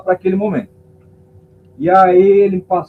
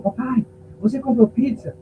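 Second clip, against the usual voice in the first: put-on child asking; 7 semitones higher than usual. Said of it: "a minha filha falou".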